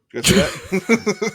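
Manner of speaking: high, slow startled question; spooked by a strange sound; surprised. Real, not acted. Reacting to a weird noise.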